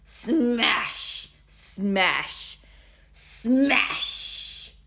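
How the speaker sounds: slow drawn-out smash, last one breathy; savouring slowly. A narrator's reading. Bully savouring smash at Davina, slow. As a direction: relish each repeat. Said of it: "as if savouring the sound".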